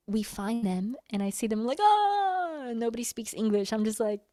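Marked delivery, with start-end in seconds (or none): 1.8-2.7 high, loud delighted "ah"; excited squeal. Retold or imitated. Reenacting seeing the musicians again, squealing with excitement. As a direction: cry out on spotting friends. Acts spotting her new friends.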